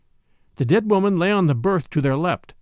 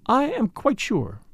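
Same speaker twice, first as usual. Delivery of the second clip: slow, considered; 6 semitones higher, slower than usual. A narrator's reading. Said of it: "she replied at length".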